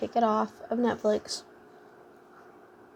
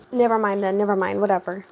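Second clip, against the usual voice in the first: louder, dismissive mock huff; eye-rolling attitude, pushed louder; attitudey brush-off; 5 dB louder than usual. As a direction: imitate an annoyed customer brushing you off. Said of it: "rolls her eyes all attitudey".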